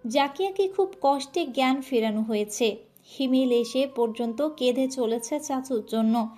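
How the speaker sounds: ordinary delivery, nothing marked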